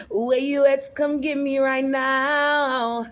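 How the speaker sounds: high, loud holler, drawn out; playful calling out. Pretend. Vlogger calling someone to fetch her, playful loud holler. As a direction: holler for someone to come get you.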